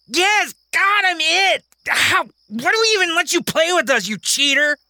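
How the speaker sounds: put-on high, angry kid cursing; high child's fury. Kingston cursing at Mary, high and angry. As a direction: lash out at her.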